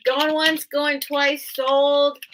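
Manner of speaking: mock auction call to the viewers; auctioneer call.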